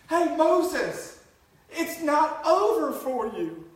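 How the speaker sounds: loud, high call to Moses; pleading call, loud and slow. Pretend. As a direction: shout into the pages to Moses. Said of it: "Don't you wish you could just".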